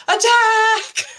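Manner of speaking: high, loud drawn-out war cry; battle yell. Acted. Player ordering her summoned wasps into battle, yelled command. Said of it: "I'm gonna yell".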